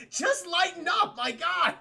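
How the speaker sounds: high, drawn-out exasperated cry; hammed-up plea breaking into a laugh; mock exasperation. Everyone begs the deadpan agent, mock exasperated.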